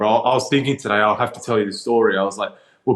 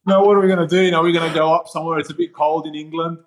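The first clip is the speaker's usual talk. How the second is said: excited retold self; 6 semitones higher, faster than usual. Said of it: "I'm like".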